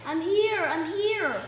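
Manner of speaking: plain reading voice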